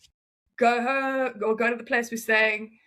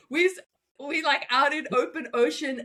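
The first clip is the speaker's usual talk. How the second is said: ordinary talk, nothing marked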